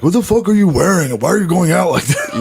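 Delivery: put-on high-pitched, indignant boyfriend scolding; controlling boyfriend. Boyfriend grilling his girlfriend on her outfit, high mock indignation. Acting out full boyfriend mode.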